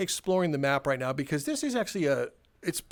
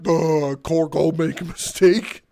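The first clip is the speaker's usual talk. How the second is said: loud, slow, dim-witted voice; dopey guy voice; 6 dB louder, slower than usual. Retold or imitated. Imitating the clumsy warehouse guy after the accident, loud and dim. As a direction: play him as a total dope. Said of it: "he's just sitting there like".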